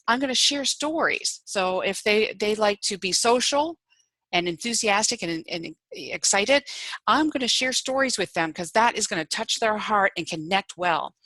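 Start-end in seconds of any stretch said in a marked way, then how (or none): none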